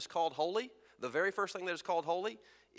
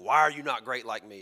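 a hint of a boastful lion, louder; lion puffing himself up; 3 semitones lower, 7 dB louder than usual. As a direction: chest puffed out, show off a bit. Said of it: "puffing out his chest and saying".

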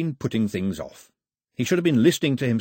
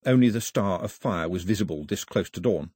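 ordinary delivery, nothing marked

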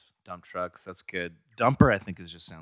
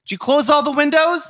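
high, nagging mother voice; put-on high-pitched mom; impression of his mom; 10 semitones higher, 3 dB louder than usual. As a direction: question your son as a fussy mom. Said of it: "You be your mom".

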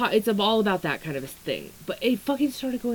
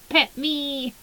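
higher, playful little plea; cutesy pet voice; 7 semitones higher than usual. Pretend. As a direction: beg like a cuddly kitten. Cutesy pet voice after laugh.

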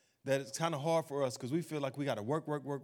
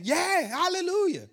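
much higher, louder, slow drawn-out cry; mock ecstatic hallelujah; 7 dB louder than usual. Preacher mimics excited churchgoers crying out hallelujah. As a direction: ham up a worshipper's ecstatic shout. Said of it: "Everybody gets so excited".

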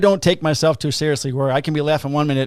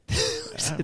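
high laugh burst; 5 dB quieter than usual. Real, not acted. Laughing at Tommy Boy memory.

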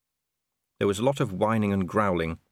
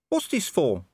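small dog voice; 8 semitones higher, slower than usual. Acted. Gaspode the dog asks.

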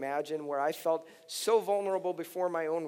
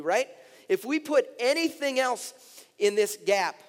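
raised, louder preacher's voice; forceful preaching; 6 semitones higher, 6 dB louder than usual. Acted. Pastor warning the congregation about anger at God, forceful preaching. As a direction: press each phrase home to the room.

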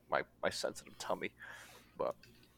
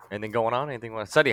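ordinary delivery

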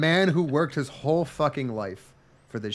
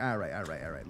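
soft mock resignation; 11 semitones lower, 11 dB quieter than usual. Pharmacist caving in.

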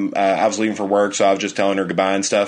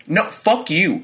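high, indignant retold comeback; pissed-off retort; 9 semitones higher, slower than usual. Fiancé to laughing fiancee after a fright, pissed. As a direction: fire back in mock outrage, pitched up. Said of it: "Like, I was pissed".

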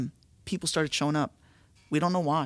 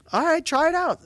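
bright, much higher, naive voice, a bit louder; childlike; 11 semitones higher, 4 dB louder than usual. Retold or imitated. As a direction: sound like an eager kid trying something. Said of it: "we were so childlike".

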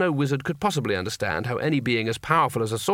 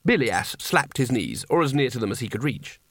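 ordinary delivery

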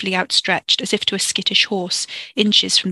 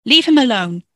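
loud, raised-pitch command; sharp intervention; 4 semitones higher, 5 dB louder than usual. Acted. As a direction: break up the fight with a loud order. Sister intervenes in fight.

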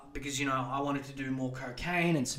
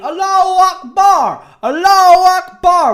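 high, loud chanted scream; mock screaming; 19 dB louder than usual. Comparing the church crowd to screaming zealots. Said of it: "isis screaming".

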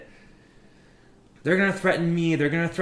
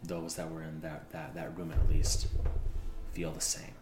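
soft reassurance; 12 semitones lower, 16 dB quieter than usual. Acted. Soft reassurance after argument.

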